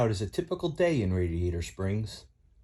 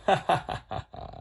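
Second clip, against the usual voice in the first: breathy chuckle; 7 semitones higher than usual. Acted. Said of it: "drove away laughing".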